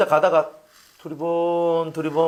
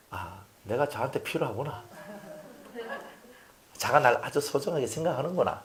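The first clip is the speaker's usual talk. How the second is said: quiet realisation; 2 semitones lower, 9 dB quieter than usual. Acted. Voices mother's quiet realisation.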